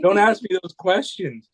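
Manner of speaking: high, pleading "stop", imitating the wife; wife begging husband to stop the Bigfoot talk, high and scared; frightened wife. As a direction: beg him to drop it, voice jumped up high. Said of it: "she goes".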